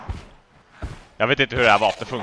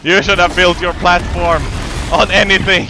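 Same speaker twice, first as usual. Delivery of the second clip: mock taunt; 5 semitones higher, 5 dB louder than usual. Real, not acted.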